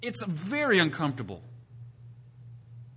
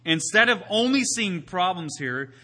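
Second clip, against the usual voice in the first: normal talking voice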